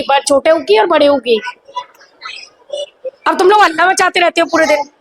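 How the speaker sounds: ordinary talk, nothing marked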